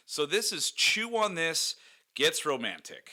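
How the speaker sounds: slow, high announcer read. Acted. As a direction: boom it out like a show host. Announcing the episode title.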